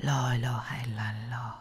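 low, frightened whisper of the prayer. Acted. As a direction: breathe the words in terror. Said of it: "زمزمه کرد".

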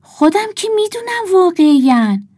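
high, loud indignant retort; indignant owl. A narrator's reading. (جواب بلند و آزردهٔ جغد)

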